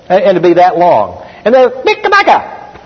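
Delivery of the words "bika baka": very high, short mock Cebuano burst. Translator rendering a long sentence in two words, high mock Cebuano. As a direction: squeak out a curt pretend Cebuano translation. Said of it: "and speak in Cebuano".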